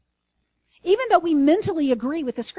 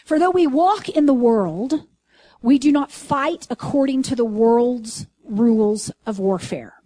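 slow, louder preaching read; emphatic scripture reading; 6 dB louder than usual. Acted. Preacher reads the verse to his congregation, slow and forceful. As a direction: proclaim the verse slowly. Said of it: "read it in the voice".

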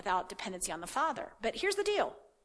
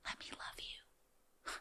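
slow, gentle whisper; calm whisper; 23 dB quieter than usual. Retold or imitated. God calming her anxious prayer in a tender whisper. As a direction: soothe her like a loving parent. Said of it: "his voice is just, just calm".

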